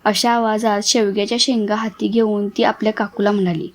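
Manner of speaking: usual speaking voice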